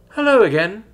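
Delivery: high, loud, cheery greeting; sing-song cheerful hello; cheerful chime. A narrator's reading. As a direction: chime it out brightly. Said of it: "she cheerfully chimed".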